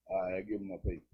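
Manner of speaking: normal talking voice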